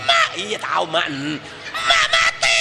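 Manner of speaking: hammed-up child crying for mom, voice jumping very high; mock wailing child. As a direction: wail for a dead mother, overdone. Child wailing mother died.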